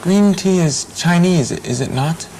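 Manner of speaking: ordinary delivery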